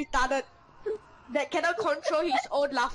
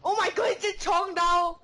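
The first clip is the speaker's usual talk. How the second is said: ordinary talk, nothing marked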